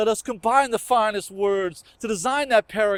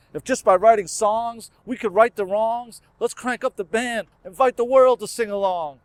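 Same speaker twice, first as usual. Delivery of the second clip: louder, ringing poetic refrain; rousing refrain; 4 dB louder than usual. Acted. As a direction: lift the refrain like a rallying call. Refrain repeated with more force.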